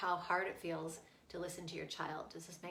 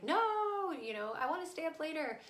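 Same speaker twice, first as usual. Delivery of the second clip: mock child's wail, high and louder; protesting child; 8 semitones higher, 4 dB louder than usual. Daughter runs from mom at bedtime, mock high protest. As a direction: imitate a kid refusing bedtime.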